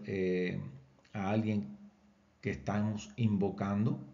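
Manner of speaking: ordinary talk, nothing marked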